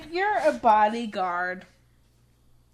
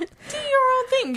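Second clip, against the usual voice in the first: put-on high sing-song girlfriend voice; sassy encouragement; 4 dB louder than usual. Hyping up a twenty-three-year-old cohost, high and sassy. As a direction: cheer her on in a high sassy voice.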